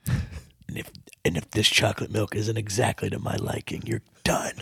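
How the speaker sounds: low, soft menacing whisper; hushed threat. Retold or imitated. Chef quietly threatening the new cook, whispered menace. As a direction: threaten him in a hush.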